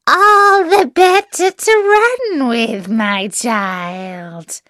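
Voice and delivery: very high, loud start dropping at the end; slow, crowing disguised wolf; wolf as grandmother. A narrator's reading.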